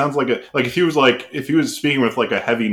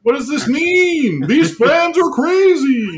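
much higher, drawn-out put-on commentator voice; Clyde impersonation; 13 semitones higher, slower than usual. Baffled commentator asking Mike about the chanting fans, hammed-up impersonation. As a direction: exaggerate a baffled broadcaster, pitched way up. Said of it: "That was Clyde".

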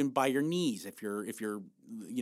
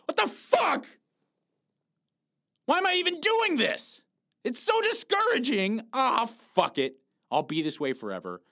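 much higher, loud mock wail of frustration; frustrated dieter; 10 semitones higher, 5 dB louder than usual. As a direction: play the dieter giving up, half crying and yelling. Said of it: "and you go".

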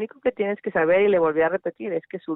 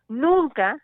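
"Nunca" pitched higher and hit hard; higher, firm "Nunca" telling a child the hard truth; blunt stress; 7 semitones higher than usual. Retold or imitated. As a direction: land "Nunca" like a slammed door. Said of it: "decirle con toda la crudeza".

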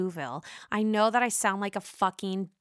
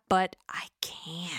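whisper; 4 semitones lower, 4 dB quieter, slower than usual. Real, not acted. Whispered confession aside.

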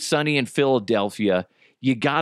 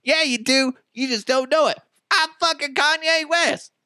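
high-pitched mock insistence; overblown, high egotist voice; 13 semitones higher than usual. Rapper arguing back at the doubters, mock bragging. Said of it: "He'd be like".

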